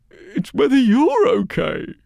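put-on high, loud, tearful old crone; weepy old woman. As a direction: cry it out like a grieving old woman.

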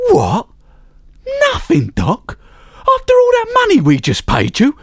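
high, loud disbelieving father; outraged father. A narrator's reading. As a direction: squawk it, outraged at the bill.

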